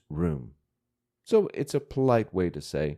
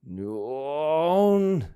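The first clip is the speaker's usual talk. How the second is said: hammed-up cartoon whimper, pitched higher; mock exaggerated wail; 6 semitones higher than usual. Shown as a demonstration. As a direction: fake a childish wail. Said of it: "it's exaggerated crying effect".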